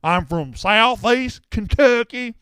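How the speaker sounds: loud, high, slow Kentucky twang; mock Kentucky accent. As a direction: stretch out an exaggerated Kentucky accent. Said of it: "You're putting on".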